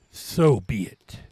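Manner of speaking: drawn-out and grave; slow dramatic line. Acted. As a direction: let each word land slowly.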